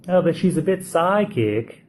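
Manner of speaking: half-hearted English accent impression; attempted English accent. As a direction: try a mock English accent and admit it falls short. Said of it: "I realize I can't do the accent".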